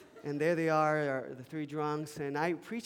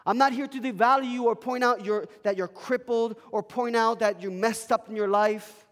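loud, higher preaching cry; full-force preaching; 6 semitones higher, 10 dB louder than usual. Acted. Pastor preaching hard to the congregation, loud and fervent. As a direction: preach it out with fire.

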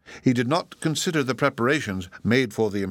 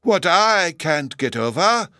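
higher, louder and slow, eagerly agreeing; wife eagerly echoing her husband; eager sympathy; 8 semitones higher, 6 dB louder, slower than usual. A narrator's reading. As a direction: chime in eagerly with the angry husband. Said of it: "in eager sympathy with her angry husband".

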